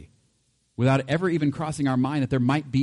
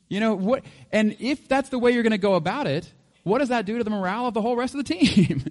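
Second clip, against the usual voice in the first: ordinary talk, nothing marked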